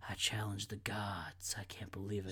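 whispered and serious, barely voiced; under their breath. Imitating understated radio actors, breathy whisper. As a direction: mutter it like a secret confession. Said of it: "under their breath kind of thing".

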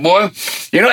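ordinary delivery

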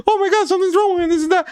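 very high-pitched mock panic; imitating frantic aunt. Aunt calling everybody for help, imitated frantic alarm. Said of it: "starts calling everybody".

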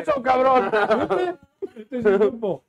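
loud, high laughing cheer; excited laughing shout. Real, not acted. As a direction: whoop it out through laughter. Cheering a sponsor joke.

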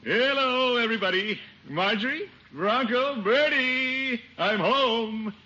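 high, bright hearty hello; cheery greeting. Acted. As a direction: sing out the greeting to all. Cheerful greeting arriving home.

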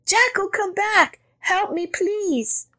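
very high, pleading rabbit; crying out. A narrator's reading. Rabbit begging Jackal to return, high plea. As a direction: plead in a high, drawn-out wail. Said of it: "Rabbit cried".

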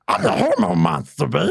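lightly voiced gross creature; hormone monster voice. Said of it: "You just doing an impression?".

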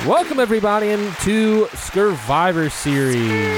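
bright, slow announcer welcome; podcast host intro. Acted. Host welcoming listeners to the show. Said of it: "show intro announcer voice".

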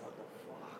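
unvoiced whisper. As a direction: mutter it under your breath. Whispered reaction to questions.

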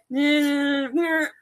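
sung-out mock horn blare; imitated marching band. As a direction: mimic badly played marching instruments. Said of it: "marching to their".

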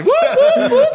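a laughing imitation of whoops from the front; laughing mock whoops. Imitating Herman whooping at the front of the dance floor, laughing.

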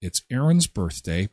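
normal talking voice

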